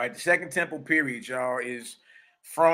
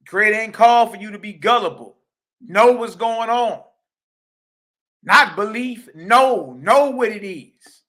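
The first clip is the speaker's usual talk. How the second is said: higher and louder, hammering each word; preaching loud; 5 semitones higher, 6 dB louder than usual. Real, not acted. Preacher urges followers to know, not believe, loud and high. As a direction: drive it home like a preacher at full force.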